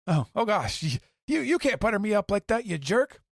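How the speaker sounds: high, fast, flustered giggly voice; a pleased little doctor waving off praise; bashful Chopper. Retold or imitated. Podcaster voicing a flattered Chopper, high and bashful. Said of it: "he gets real bashful".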